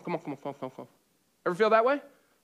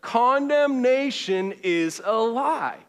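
slow, loud and higher; for the back; 5 semitones higher, 5 dB louder, much slower than usual. Shown as a demonstration. Pastor calls it once more for the crowd in back. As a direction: project it to the back row. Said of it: "One more time for the crowd in the back".

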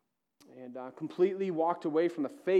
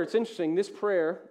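ordinary talk, nothing marked

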